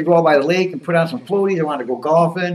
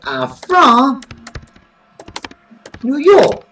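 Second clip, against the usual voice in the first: put-on character voice, pitched up and swinging; Skip. Skip chatting to viewers about wrestling, put-on excitable character voice. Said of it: "So, so it's Skip now".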